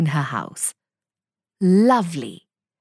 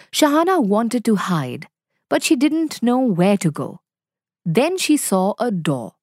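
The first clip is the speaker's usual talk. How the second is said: usual speaking voice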